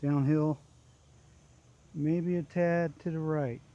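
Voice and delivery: ordinary talk, nothing marked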